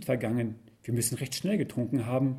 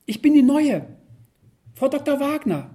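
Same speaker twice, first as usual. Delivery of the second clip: blurting out loud; 10 semitones higher, 7 dB louder than usual. Acted. Bursting to break the silence.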